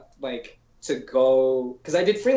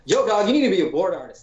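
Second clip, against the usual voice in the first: mock pushy coworker; 7 semitones higher, 6 dB louder than usual. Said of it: "we're like".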